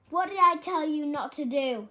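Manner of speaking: loud, high furious yell; angry yell. A narrator's reading. Tired dormouse yells at the rabbits. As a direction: demand an answer, shouting. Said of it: "he yelled at the rabbits".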